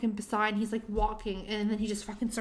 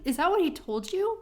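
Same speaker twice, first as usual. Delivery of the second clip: retold outrage; 8 semitones higher, 4 dB louder than usual. Retold confrontation at the bar.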